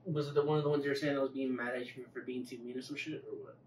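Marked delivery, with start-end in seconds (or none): none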